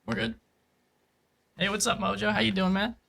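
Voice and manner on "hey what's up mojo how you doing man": ordinary talk, nothing marked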